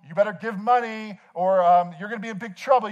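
mock warning, high and loud; guilt-fueled threat. Preacher imitating guilt-driven giving appeal, mock threatening. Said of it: "guilt fueled".

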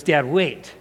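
"Dad, wait" said simply and deliberately; serious little request to hold on; earnest interruption. Retold or imitated. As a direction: stop Dad in earnest, without pestering. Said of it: "he said it like that".

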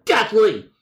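high, theatrical outburst at modest volume; mock yell. Caller repeating his allergy complaint, mock yell. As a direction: hammer the word in hammed-up outrage. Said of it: "you don't need to yell at me".

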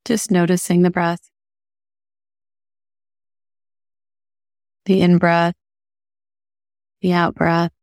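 calm, even meditation voice with long pauses; unhurried guiding, leaving space between phrases; guided meditation. Acted. Host guiding listeners through breathing, calm meditation voice.

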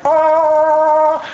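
long, high, loud held "oh"; heavenly movie-moment chord. Retold or imitated. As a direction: mimic an angelic movie swell. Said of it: "when, like, the lights are behind him".

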